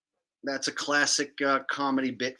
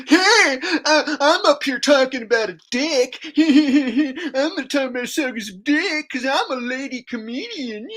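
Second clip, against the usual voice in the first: higher and louder, giggly, hammed-up mock lady comic; high giggling caricature of a lady stand-up; mock female comedian; 8 semitones higher, 5 dB louder than usual. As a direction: parody a woman comic laughing at her own dick joke. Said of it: "try and be all like".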